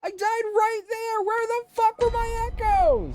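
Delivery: high, indignant yelling; real frustrated outburst; angry yell. Real, not acted. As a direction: vent at the game after dying. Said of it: "I'm so mad!".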